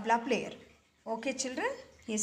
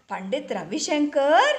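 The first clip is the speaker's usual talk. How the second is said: surprised; 4 semitones higher, 6 dB louder than usual. A narrator's reading. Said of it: "he said".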